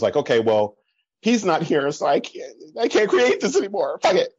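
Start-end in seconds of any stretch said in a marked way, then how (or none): 1.2-4.3 high-pitched mock despair, throwing up the hands; hammed-up self-pity, giving up; dramatic. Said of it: "it was dramatic".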